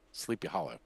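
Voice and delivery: usual speaking voice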